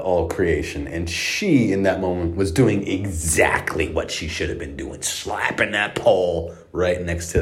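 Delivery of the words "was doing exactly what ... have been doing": usual speaking voice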